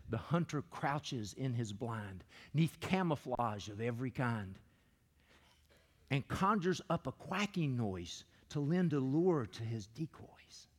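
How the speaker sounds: slow, low poem recitation; poem reading. Acted. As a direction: read the poem slowly and deep. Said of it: "It says".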